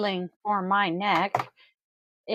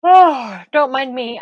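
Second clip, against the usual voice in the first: loud, higher groggy yawn; sleepy; 4 semitones higher, 11 dB louder than usual. Real, not acted. Groggy streamer excusing herself to viewers, sleepy. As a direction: yawn it out big and high. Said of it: "I am a little sleepy, groggy today".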